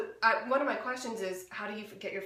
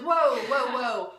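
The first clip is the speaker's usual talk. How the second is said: loud, higher-pitched mock alarm; retold alarm; 3 semitones higher, 8 dB louder, much slower than usual. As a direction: halt a friend in her tracks. Retells alarm at friend quitting.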